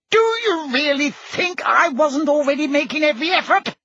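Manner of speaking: very high-pitched, exasperated demand; heated. A narrator's reading. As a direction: demand it indignantly at the top of the voice's range. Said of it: "demanded Fudge heatedly".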